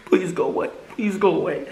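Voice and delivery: high-pitched little plea; retold pleading. Waiting for the Benadryl to work, high pleading voice. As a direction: re-enact begging, voice lifted high.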